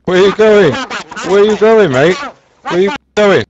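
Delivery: high, loud shout after a runaway; calling out. Pretend. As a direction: yell after him as he flees. Cop chasing a fleeing player.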